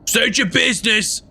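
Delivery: high-pitched demanding call; yelled guard's challenge. Acted. Armed pirate demanding strangers explain themselves, yelled. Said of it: "one of them just yells".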